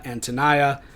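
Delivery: Antonia as an-tuh-NYE-uh; variant pronunciation. Shown as a demonstration. Lecturer listing heard variants, Antonia pronounced an-tuh-NYE-uh. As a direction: say Antonia with NYE, rhyming with eye. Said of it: "I've heard her name pronounced differently".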